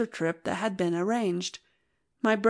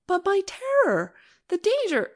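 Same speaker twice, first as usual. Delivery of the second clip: frightened; 11 semitones higher than usual. Acted. Wife fears husband's war danger.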